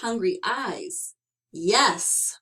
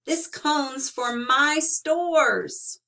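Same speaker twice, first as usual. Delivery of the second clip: shouted; 6 semitones higher, 4 dB louder, much slower than usual. Acted. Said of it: "shouted Bruce".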